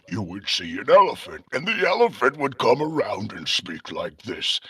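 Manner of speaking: put-on talking elephant voice; change your timbre into a cartoon elephant's. Shown as a demonstration. Only child inventing animal voices, elephant voice. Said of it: "I would even do voices of animals".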